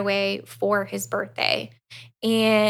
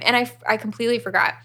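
ordinary delivery

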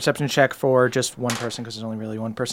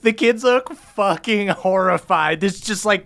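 ordinary talk

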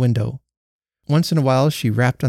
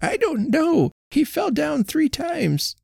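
put-on much higher old wife, slowing; distressed old woman; slower than usual. A narrator's reading. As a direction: answer the neighbour helplessly. Old wife answers neighbour.

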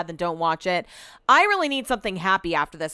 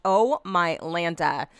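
ordinary talk

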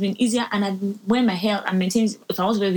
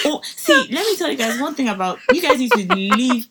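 high excited burst into a rant; 5 semitones higher, 4 dB louder than usual. Real, not acted. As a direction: fire up, ready to rant. Launching into a rant.

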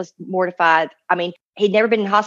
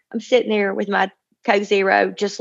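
ordinary talk, nothing marked

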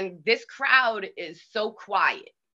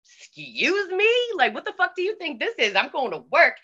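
indignant retort; 7 semitones higher than usual. Retold or imitated. To her friend at the strip club, retold indignant. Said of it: "And I looked at him like".

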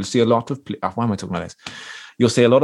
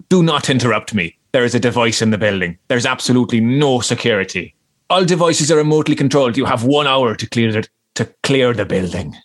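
mock Irish hoax caller giving orders; hammed-up Irish bomb threat; fake Irish accent. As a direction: deliver the warning firmly in a put-on Irish accent. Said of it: "put on an Irish accent".

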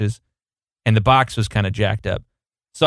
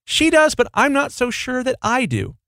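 coy retort; 12 semitones higher than usual. Retold or imitated. Retold reply to the manager.

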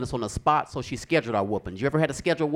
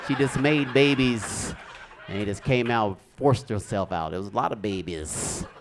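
usual speaking voice

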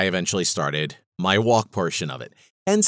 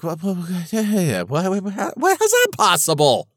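higher, stammering mock astonishment; astonished disbelief; 8 semitones higher than usual. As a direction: sputter in comic disbelief. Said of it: "And I'm like".

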